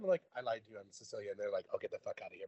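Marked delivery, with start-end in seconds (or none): 0.4-1.3 sheepish confession. Retold or imitated. Retold confession again. 1.7-2.5 dismissive Italian friend. Retold or imitated. Italians mocking her.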